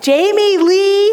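a parent's warning tone; parent scolding child. Retold or imitated. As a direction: call the child's full name like she's in trouble. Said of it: "my parents would say my first name and my middle name".

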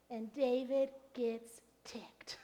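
hushed, slow whisper. Acted. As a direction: lean in and whisper it like a secret. Dramatic aside.

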